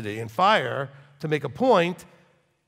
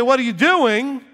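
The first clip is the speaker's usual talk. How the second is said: high, loud, drawn-out demand; confronting shout; 9 semitones higher, 9 dB louder than usual. Shown as a demonstration. Rabbi confronting someone to make a point, loud. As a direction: get in their face to grab attention. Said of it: "When I look at you and say".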